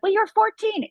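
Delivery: high, loud dismissive voice; mock the people brushing it off; mocking other people. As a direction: toss it off like a know-it-all bystander. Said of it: "people are like".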